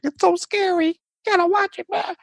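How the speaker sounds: very high mock spooky pitch; hyped-up kid. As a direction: squeal it like an excited kid. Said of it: "people were like".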